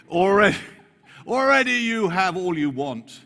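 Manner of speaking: high, loud, laughing mockery; mocking sarcasm. Paul mocking the proud Corinthians, high loud sarcasm. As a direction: sneer it out with a laugh. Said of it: "He's mocking them".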